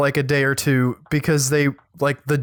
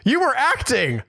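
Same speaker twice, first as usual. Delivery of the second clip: high, breathy-free mock surprise, much higher pitch; mock shocked viewer; 8 semitones higher, much slower than usual. Mocking a stunned fan who just learned she was acting, in a high put-on voice. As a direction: play a gushing fan stunned the actress is Australian.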